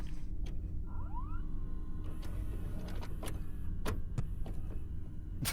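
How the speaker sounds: long, quiet, squeaking wheeze; high wheezing laugh. Real, not acted. Reader cracking up about scared zoomers, high wheezing laugh. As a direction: lose it laughing on almost no voice.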